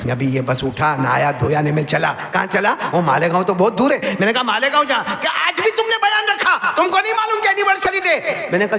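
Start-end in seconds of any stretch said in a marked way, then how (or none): 4.4-8.5 higher, indignant wife's protest. Retold or imitated. Wife protesting her husband's trip on their anniversary, mock indignant. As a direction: protest like a miffed wife, hammed up.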